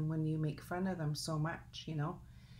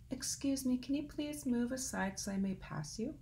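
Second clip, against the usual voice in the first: polite wren; 6 semitones higher than usual. Acted. Tiny wren asks politely.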